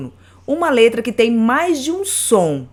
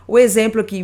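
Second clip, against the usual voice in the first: usual speaking voice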